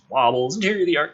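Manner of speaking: ordinary delivery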